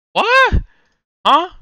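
shrieked high and loud; a sudden squeal of disbelief; shocked what. Real, not acted. Baffled by the spelling.

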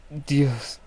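loud, falling cry to God; rattled and loud; shocked exclamation. Real, not acted. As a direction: gasp out shock after a scare. Right after the jump scare.

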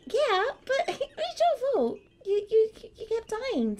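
giggling accusation; laughing. Real, not acted. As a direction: blame her through laughter. Laughing blame.

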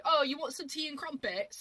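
high, slow, over-the-top Queen's English; exaggerated British accent. Shown as a demonstration. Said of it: "the over-exaggerated".

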